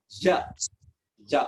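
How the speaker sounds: "Je" said twice for the class to echo; "je" drilled for the class; repeat after me. Shown as a demonstration. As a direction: model "je" for repetition. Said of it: "repeat after me".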